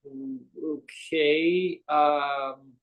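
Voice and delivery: very slow, drawn-out okay; mock bewildered caller, stalling; puzzled hesitation. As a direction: stretch it out, thrown and unsure. Puzzled caller hearing his own voice.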